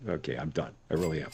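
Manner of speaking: low, soft resigned voice; defeated giving up. Retold or imitated. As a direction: mumble it out like someone who has quit. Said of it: "It's like".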